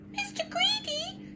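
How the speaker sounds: tiny, very high squeak; scared little man squeaking his name to the giant; frightened squeak. A narrator's reading. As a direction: squeak it out, terrified. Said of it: "he squeaked".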